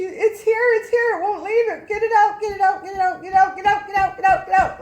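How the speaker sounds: high, screaming imitation of sheer panic; reenacted hysterical pleading at full volume; freaking out. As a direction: shriek it in a rapid, desperate loop. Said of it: "like that, just freaking".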